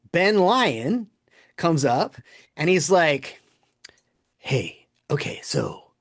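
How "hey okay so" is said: conspiratorial hushed whisper; sneaky retold whisper. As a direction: lean in and whisper the secret. Prankster sneaking up to confess.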